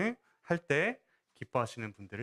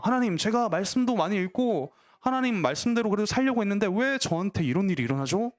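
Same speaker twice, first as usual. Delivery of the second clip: higher, aggrieved whine at God; mock angry complaint; 8 semitones higher, 5 dB louder than usual. Child voicing a complaint to God, mildly angry. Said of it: "라고 화를 내기도 해요".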